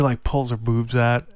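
ordinary talk

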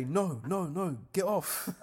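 imitating the friend fending him off; mock protest. Friend refusing the bracelet seller, high loud mock protest.

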